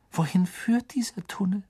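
breathy unvoiced whisper. A narrator's reading. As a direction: hush it, afraid of being heard. Hermine asks in the dark tunnel. (ängstliches Flüstern)

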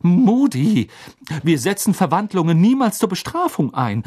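high, loud scolding; indignant reprimand. Acted. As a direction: call the name sharply, then rebuke. Professor reprimands Moody.

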